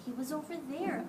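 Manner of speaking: ordinary delivery, nothing marked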